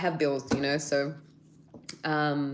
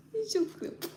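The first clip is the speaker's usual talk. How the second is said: cooing at pet; 5 dB quieter than usual. Real, not acted. Cooing at her dog.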